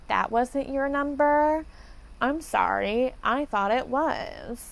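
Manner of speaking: slow, drawn-out singsong feigned surprise; mock innocent apology. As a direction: imitate a falsely sorry clerk, stretching the endings. Mocking retold fake-innocent clerks.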